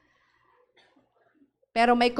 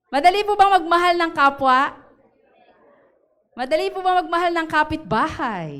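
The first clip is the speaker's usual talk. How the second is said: loud, higher rhetorical challenge; preaching at full force; 3 semitones higher, 5 dB louder than usual. Acted. Pastor challenging the congregation with loud rhetorical questions.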